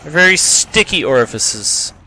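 ordinary delivery

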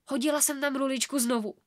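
usual speaking voice

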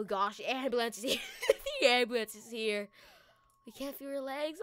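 ordinary talk, nothing marked

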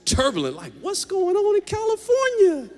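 high, loud, half-laughing disbelief; mock worried locals. Locals reacting to the storm, a rising mock squeal. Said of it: "they're like".

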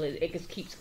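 ordinary talk

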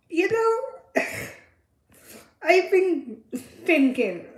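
ordinary talk

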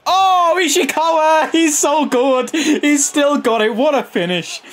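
high, loud delighted yelling, slowing at the end; over-the-moon goal cheer; ecstatic celebration. Real, not acted. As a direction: scream with joy at the finish.

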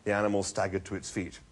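ordinary delivery, nothing marked

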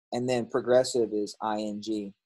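ordinary talk

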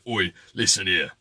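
low, rough "Oi, listen here"; gruff tough-talking voice. Retold or imitated. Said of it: "Karen doesn't talk like that".

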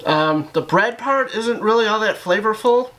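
chewing while talking; talking between bites; mouth full of pizza. Real, not acted. Seen 0: Bites at -9, jaw and cheeks keep chewing through 10.9.

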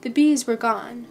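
ordinary delivery

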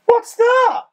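sudden high yelp of surprise; surprised. Real, not acted. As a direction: blurt it out, startled and loud.

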